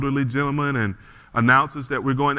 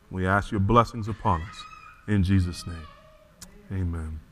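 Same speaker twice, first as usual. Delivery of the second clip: congregational prayer; 4 semitones lower than usual. Acted. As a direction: pray humbly over the church. Pastor leads a prayer.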